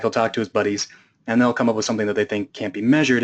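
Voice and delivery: normal talking voice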